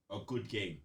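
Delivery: ordinary talk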